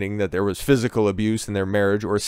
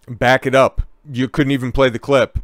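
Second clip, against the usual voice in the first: ordinary talk, nothing marked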